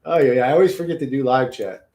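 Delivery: ordinary talk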